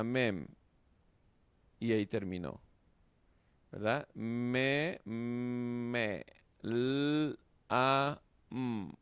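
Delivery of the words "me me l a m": "Me, me, l, a, m" with each sound held long and separate. Shown as a demonstration. Teacher shows students how to read it, sounding out "me, l, a, m" slowly. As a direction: stretch out "me", then hum "l", "a", "m" one by one. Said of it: "Esa es la manera".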